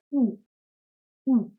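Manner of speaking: "U" said as a pure oo; German letter name. Shown as a demonstration. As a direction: say "U" as oo, without a y glide. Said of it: "is pronounced as".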